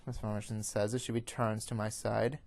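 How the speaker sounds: ordinary delivery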